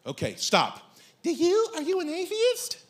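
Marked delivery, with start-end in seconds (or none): 1.2-2.8 high put-on woman's voice; mock tentative female questioning; dating-show woman. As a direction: ask it girlishly, pitched way up.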